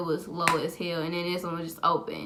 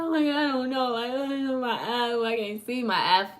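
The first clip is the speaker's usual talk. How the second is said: high, whiny crying imitation; mock bawling; 4 semitones higher than usual. Bawling to dramatic mom about her eye, high mock sob. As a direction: whimper it high, acting out a bawling fit. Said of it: "so I'm just bawling".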